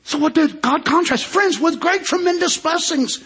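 high, ringing preacher's call; preaching climax. Acted. Pastor drives home the contrast to friends, rising preaching. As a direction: build it up to the point.

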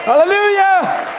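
high, loud, building sermon climax; a preacher rousing the congregation to trust Jesus; full-force preaching. Acted.

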